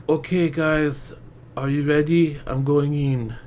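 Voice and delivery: unbothered, slow and level; mock calm actress. Mocking the actress, going into shark water oddly calm. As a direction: shrug off danger like it's nothing. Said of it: "So freaking calm".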